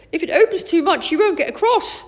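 loud, high-pitched warning; urgent call. A narrator's reading. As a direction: keep calling out, high and urgent. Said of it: "called Chip".

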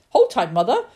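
loud warning call. A narrator's reading. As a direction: call it out to mother. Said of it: "he called".

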